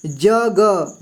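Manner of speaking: ordinary talk, nothing marked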